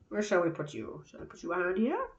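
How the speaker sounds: playful mock French accent; weird French accent. Musing to the rock about where to put it, French accent. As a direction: slip into a softly spoken French accent. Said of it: "weird French accent came from".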